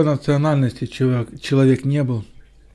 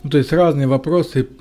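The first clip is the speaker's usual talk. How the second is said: normal talking voice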